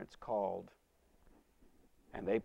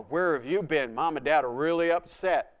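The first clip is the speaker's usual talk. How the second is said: put-on louder, urgent older brother; worried scolding; 4 semitones higher, 10 dB louder than usual. Older brother at the door chides him, urgent scolding.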